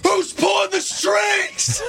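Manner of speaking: high, drawn-out heckler's yell; imitated fan yell. Host imitating one fan blurting out at a wrestling show, high stretched yell. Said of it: "you just hear one fan yell".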